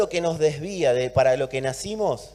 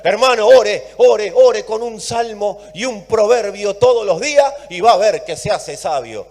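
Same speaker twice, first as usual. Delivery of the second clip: louder, imitating a preachy brother; mock pious advice; 4 semitones higher, 8 dB louder than usual. Mocking a brother's pious advice to the congregation. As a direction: parrot the well-meaning advice mockingly.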